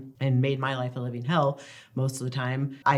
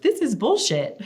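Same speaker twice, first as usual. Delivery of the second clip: put-on higher, louder indignant kid outburst; indignant child; 11 semitones higher, 5 dB louder than usual. Mom retelling son's reaction to shock toy, indignant. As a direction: huff it like an offended little boy. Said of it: "went through all stages of anger".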